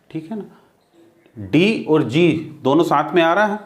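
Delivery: usual speaking voice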